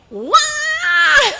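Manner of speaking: loud high mock bawl; imitated serious child wail. Preacher imitating a child crying in earnest, loud wail. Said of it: "anak nangisnya serius nih".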